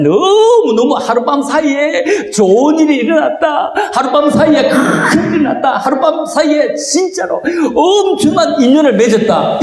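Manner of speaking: high-pitched, gushing imitation; acted-out thrill, pitched high; mock excited belief. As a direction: gush about it as people imagine it. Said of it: "이렇게 생각을 해요".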